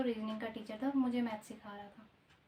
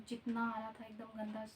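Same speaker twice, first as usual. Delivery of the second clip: ordinary talk, nothing marked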